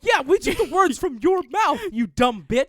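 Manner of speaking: loud, high-pitched indignant outburst; angry rant. Acted. Telling off Kristi over her own book, angry rant. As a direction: tear into an absent politician. Ranting at absent politician.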